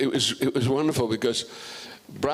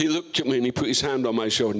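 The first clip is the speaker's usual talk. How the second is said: usual speaking voice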